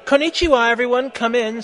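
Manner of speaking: lightly acted teacher's welcome; lightly voiced teacher. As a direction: greet a class in a lifted voice. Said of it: "said Professor Sinister in Japanese".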